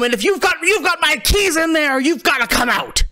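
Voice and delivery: high voice insisting he must get home; high voice urging someone to come out; pleading shout. Acted. Harbor master yelling through door.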